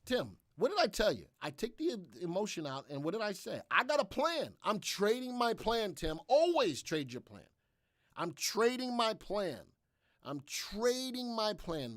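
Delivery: emphatic lecturing, stretching the repeated line; emphatic insistence. Real, not acted. Emphatically lectures Tim on trading plan.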